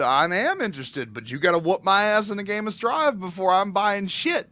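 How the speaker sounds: high, loud, insistent retort; hammed-up challenge. Telling a telemarketer to win a game first, loud mock insistence. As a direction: imitate yourself goading a caller.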